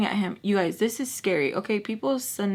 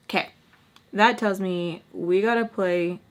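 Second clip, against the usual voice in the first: ordinary talk, nothing marked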